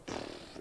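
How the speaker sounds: soft airy pfft of an engine blowing; voiceless mouth-made burst; explosion noise. Retold or imitated. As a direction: puff out a small explosion sound.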